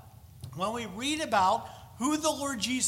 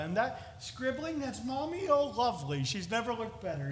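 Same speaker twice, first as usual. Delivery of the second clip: gentle talk to toddlers; 3 semitones higher than usual. Retold or imitated. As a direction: praise a little child's scribbles tenderly. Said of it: "it was with gentleness".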